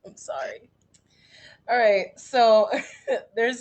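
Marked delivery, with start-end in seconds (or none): 0.1-0.7 nervous whisper. Real, not acted. Nervous before performing, pressured.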